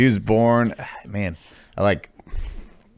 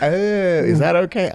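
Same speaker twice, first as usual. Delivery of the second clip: mock timid; 12 semitones higher, 6 dB louder than usual. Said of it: "They're like".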